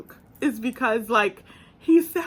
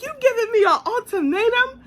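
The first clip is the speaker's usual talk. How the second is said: mock boyfriend question; 10 semitones higher, 5 dB louder, faster than usual. Voicing boyfriend's reply.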